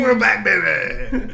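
hype shout. Acted. Triumphant hype callback.

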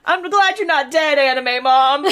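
high, gushing address to a character; hammed-up relief to an anime mother; mock-tearful relief.

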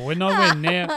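protest, outraged and squeaky; incredulous. Real, not acted. Disputes partner's realistic claim.

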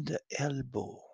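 plain reading voice

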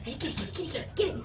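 hushed, rapid urging; soft, quick-fire prompts; egging the dog on. Real, not acted. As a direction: whisper it eagerly to a dog. Egging dog on to grab toy.

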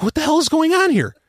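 imitating a high, baffled outcry. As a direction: replay the panic, voice jumps up. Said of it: "We're like".

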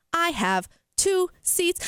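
stilted phone-system impression; robot voice. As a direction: mimic a robot reading out options slowly. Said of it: "mimic this robot voice".

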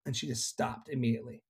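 ordinary talk

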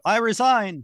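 high, loud outburst; shouted exclamation. Retold or imitated. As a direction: shout it out as a catchphrase. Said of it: "I always enjoy occasionally shouting out".

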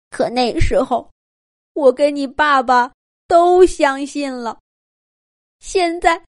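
pained, speaking into her hands; slow, pained words through covered face. Acted. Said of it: "老鼠妈妈捂着脸， 痛苦地说道".

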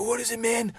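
high, soft and pressing; urgent question. Retold or imitated. Narrator asks the dying man what he means, urgent. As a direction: lean in and ask. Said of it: "I go".

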